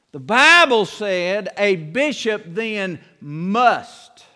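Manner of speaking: "a bishop then must" slowed, with weight on "must"; slow down and press on "must"; stress on must. Shown as a demonstration. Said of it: "These things are not optional".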